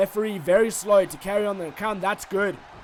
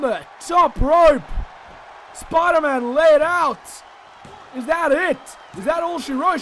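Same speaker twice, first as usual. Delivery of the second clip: wrestling commentator; 5 semitones higher, 5 dB louder than usual. Acted. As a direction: yell the big move like a ringside announcer. Climax of wrestling match commentary.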